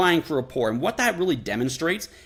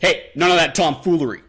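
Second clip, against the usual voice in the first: louder, stern bouncer; bouncer warning; 2 semitones higher, 7 dB louder than usual. Retold or imitated. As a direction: bark it down from above. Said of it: "the bouncer at the club".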